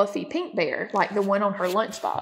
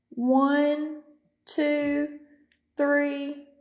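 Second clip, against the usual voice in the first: little mouse counting; 6 semitones higher, much slower than usual. Acted. Mice counting in hide and seek.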